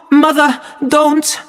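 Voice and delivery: put-on high, pleading child; frightened plea. A narrator's reading. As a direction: beg her to stop. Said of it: "he cried out".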